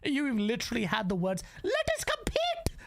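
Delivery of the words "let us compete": much higher sing-song mock of the CEO; sneering impression of the boss's slogan; mocking Satya Nadella. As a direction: parrot the CEO's promise in a silly high voice. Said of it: "from frickin' Satya Nadella".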